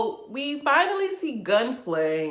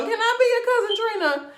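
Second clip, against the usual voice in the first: high, loud, laughing mock plea; 7 dB louder than usual. Asking Trina to be her cousin, high laughing plea. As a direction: beg to join the family, cracking up.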